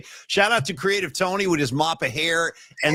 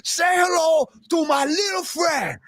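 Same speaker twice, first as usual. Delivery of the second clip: Scarface impression; 10 semitones higher than usual. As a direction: bark it like Tony Montana. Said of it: "end of Scarface".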